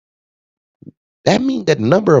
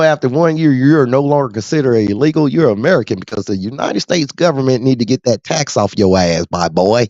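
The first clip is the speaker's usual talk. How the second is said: ordinary talk, nothing marked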